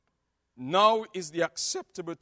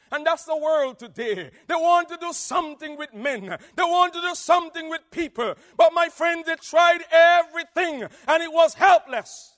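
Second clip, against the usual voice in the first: rising, louder sermon cry; fiery preaching; 9 semitones higher, 9 dB louder than usual. Acted. Preacher rousing the congregation, louder and higher. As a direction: preach it out to the crowd.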